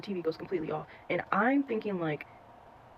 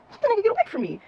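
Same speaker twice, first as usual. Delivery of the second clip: loud, high-pitched mock protest; acted indignant protest; 7 dB louder, much faster than usual. Child answers the worried brother, high indignant protest. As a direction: shoot the pitch up, pushing him away.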